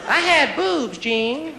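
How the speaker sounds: high, eager contestant answer; game show contestant. Retold or imitated. Contestant answering the host Gene on Match Game, eager. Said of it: "boobs is an answer now on Match Game".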